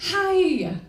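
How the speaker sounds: high, loud mock-bright greeting; fake cheerful hi. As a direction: chirp it like a cheery hello.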